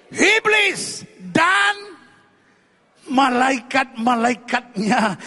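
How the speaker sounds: high, loud, stretched-out proclamation; drawn-out preaching cry; emphatic shout. Real, not acted. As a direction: cry each word out slowly to the crowd. Preacher proclaims answer to congregation.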